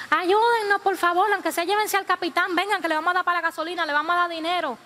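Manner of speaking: high-pitched, urgent plea; acted begging. Passengers begging the fishermen to take the captain, pleading. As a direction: beg and bargain for a rescue. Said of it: "después de tanto rogarle".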